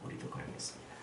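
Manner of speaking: 우리도 그러겠습니다 whispered without voice. Shown as a demonstration. Preacher whispering the disciples' vow. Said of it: "이렇게 했다는 거예요".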